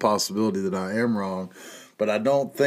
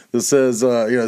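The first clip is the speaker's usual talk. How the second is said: usual speaking voice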